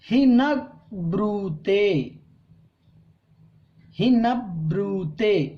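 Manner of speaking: हि न ब्रूते said slowly, stressing हि; slow recitation. Shown as a demonstration. Teacher shows students how to say it. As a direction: stretch हि न ब्रूते out, word by word. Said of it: "ऐसे ही बोलेंगे".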